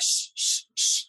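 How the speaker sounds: hushed unvoiced shh; whispered shh; shushing. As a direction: shush like a mother quieting a child. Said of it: "good Southern woman".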